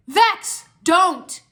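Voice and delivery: high, loud command to stop; shouting. A narrator's reading. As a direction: shout to halt an attacker. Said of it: "Zane shouted".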